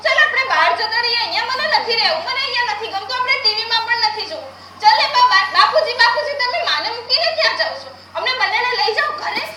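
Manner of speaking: put-on a scared child begging to be taken home; frantic pleading. Unborn girl begging father not to leave, frantic.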